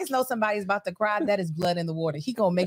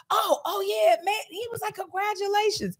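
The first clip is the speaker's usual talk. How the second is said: imitating Marcus; 12 semitones higher than usual. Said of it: "And he was like".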